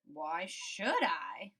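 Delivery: low, slow, lazy turtle voice. Acted. Turtle refusing Frog, low and slow. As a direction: drag the words out like an old turtle.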